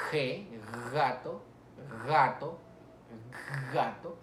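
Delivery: gato with a long rasping onset on the G; velar G. Shown as a demonstration. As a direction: stretch the G of gato at the back of the mouth. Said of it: "con el velo del paladar".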